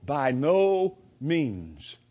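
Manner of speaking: slow, drawn-out BY NO MEANS; emphatic denial. Retold or imitated. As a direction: stretch out "By no means", low and firm. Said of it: "he says it very emphatically".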